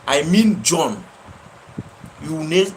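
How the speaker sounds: ordinary talk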